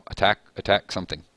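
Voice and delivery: ordinary talk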